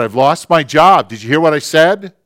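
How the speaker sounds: acted husband's vehement yell; angry shouting. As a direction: shout at your wife, demanding to be heard. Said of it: "the vehemence of his voice shouting at her".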